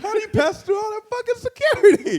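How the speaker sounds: very high, loud disbelief through laughter; laughing, squealed mock astonishment; incredulous outburst. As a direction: exclaim it, cracking up. Amused disbelief at Jeff getting in.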